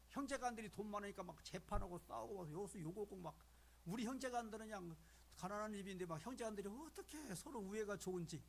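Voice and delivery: ordinary talk, nothing marked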